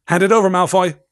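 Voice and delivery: loud, stern order. A narrator's reading. Percy the prefect ordering Malfoy, loud and stern.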